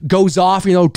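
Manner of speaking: ordinary talk, nothing marked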